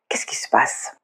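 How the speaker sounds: hushed, breathy question to herself; whispered puzzlement. Acted. Whispering her confusion about the conversation to viewers. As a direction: whisper it as if wondering aloud.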